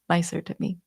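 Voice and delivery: ordinary talk, nothing marked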